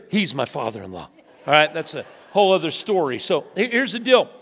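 normal talking voice

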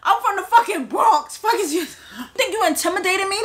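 high-pitched defiant challenge; retold defiant yelling. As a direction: act out standing up to him, pitched well up. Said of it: "I start yelling at him".

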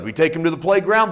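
ordinary delivery

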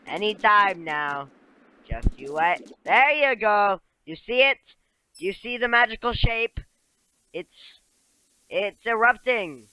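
loud, rising storyteller voice for a game character; hammed-up village elder, loud and eager; excited game character.

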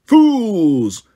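loud, roaring giant voice; giant's roar. Acted. A giant roars at the fleeing girls, loud. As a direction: bellow it like an angry giant. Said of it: "the giant roared".

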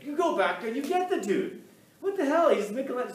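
high, loud exasperated outburst; angry Pope. Acted. The Pope yelling at his messengers to fetch Michelangelo, high and exasperated. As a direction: blow up at the useless messengers.

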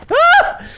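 very high, loud yelp; short piercing scream; startled shriek. Real, not acted. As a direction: squeal in sudden fright. Worm attacked again.

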